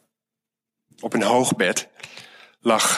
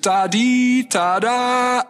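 high siren "tadi tada"; high, loud mock siren call; siren imitation; 13 semitones higher, 3 dB louder, much slower than usual. A narrator's reading. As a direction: kids imitating an ambulance siren.

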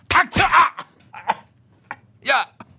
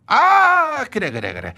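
sudden realization; 6 semitones higher, slower than usual. Acted.

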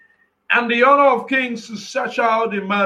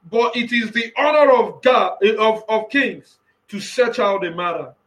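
ordinary delivery

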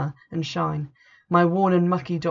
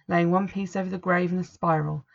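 ordinary delivery

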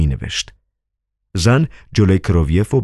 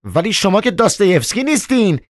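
much higher, irritated retort; annoyed; 14 semitones higher than usual. Acted. The angry doorwoman retorts to Koroviev, much higher. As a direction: push back, annoyed. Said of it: "عصبانی شده بود گفت".

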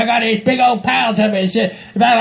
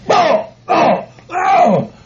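alarmed cries; 3 semitones higher, much slower than usual. Acted.

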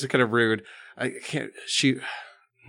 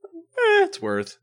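usual speaking voice

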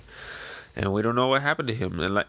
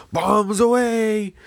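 much higher, louder, slow drawn-out yell; gleeful battle cry; 11 semitones higher, 6 dB louder, much slower than usual. Acted. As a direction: holler it as the bombs drop. Pirates throwing bombs.

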